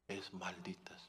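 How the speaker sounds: whispered condemnation. Acted. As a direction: drop to a hushed whisper for the curse. Preacher whispers the curse.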